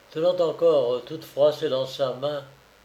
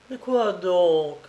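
higher, slow, anxious voice; anxious question; 4 semitones higher, slower than usual. Acted. Worried mother begging her daughter to explain, slow and higher. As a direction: dread in a slow pleading question. Mother urgently asks what happened.

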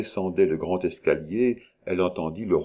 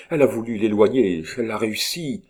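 louder, hurried indignation; angry; 3 semitones higher, 5 dB louder, faster than usual. A narrator's reading. Rose accusing the countess of scheming, angry. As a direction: accuse her in restrained anger. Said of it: "dont la voix tremblait de colère".

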